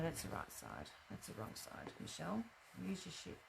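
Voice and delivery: whispered scolding to herself; whispering to self. Real, not acted. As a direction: mutter it under breath. Muttering at herself.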